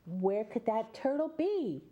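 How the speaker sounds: ordinary delivery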